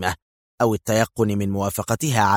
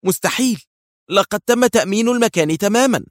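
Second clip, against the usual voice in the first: alarmed indignation; 10 semitones higher than usual. A narrator's reading. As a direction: cry out in disbelief and alarm. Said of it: "وهتفت مستشارة الأمن القومي بكل ذعر واستنكار الدنيا". (بذعر واستنكار)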